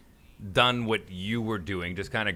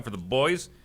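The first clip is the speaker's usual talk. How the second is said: ordinary talk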